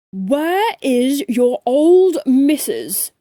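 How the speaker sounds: slow, loud, plummy bellow; pompous gentleman roaring; posh shout. A narrator's reading. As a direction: bark it out like an angry toff. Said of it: "he shouted".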